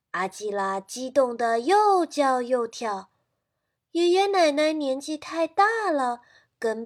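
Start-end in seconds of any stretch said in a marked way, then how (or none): none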